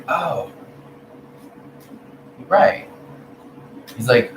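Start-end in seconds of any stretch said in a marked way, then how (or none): 0.1-2.9 high, sudden "oh, you're right"; mock-caught-out admission; a light mock surprise; sheepish realization. Her sheepish reply retold.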